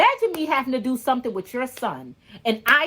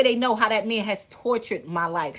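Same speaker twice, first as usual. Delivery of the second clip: usual speaking voice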